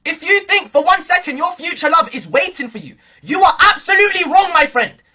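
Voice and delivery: ordinary delivery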